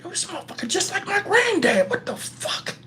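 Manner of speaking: hushed, high amazed whisper; acted-out awed whisper; inner thought. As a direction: whisper it to yourself in disbelief. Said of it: "In my head, I'm like".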